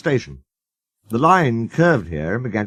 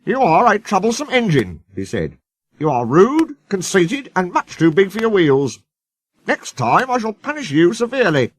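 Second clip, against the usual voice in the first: stern, raised scolding voice; severe scolding; 9 semitones higher than usual. A narrator's reading. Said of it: "was severe with Sir Handel".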